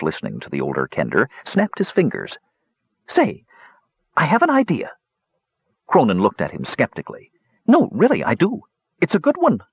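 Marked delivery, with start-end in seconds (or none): 3.1-7.3 high-pitched eager discovery. Acted. Talarin eager with a new idea. 7.7-9.6 high, keen kender voice; eager insistence. Acted. Talarin insisting to skeptical Cronin.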